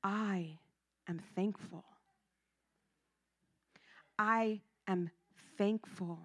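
soft, calm, steady reply. Real, not acted. Stopping the hysterical relative on the phone: soft and very calm. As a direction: calmly stop her in her tracks. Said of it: "I stopped her very calmly".

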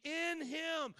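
"in" hit hard, "in him" pitched high; stress on IN. Shown as a demonstration. Preacher pointing listeners to the terminology, emphatic "in him". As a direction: lift and drive home "in him". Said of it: "listen to that terminology".

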